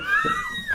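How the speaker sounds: squealing laugh; high shrieking laugh. Real, not acted. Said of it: "I was just dying".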